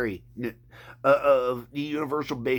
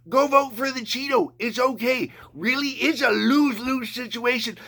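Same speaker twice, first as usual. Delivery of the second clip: raised, higher-pitched exhortation; impassioned rallying; 7 semitones higher than usual. Real, not acted. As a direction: urge the crowd on, punching each phrase. Rallying listeners to get angry, vote.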